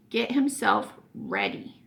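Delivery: usual speaking voice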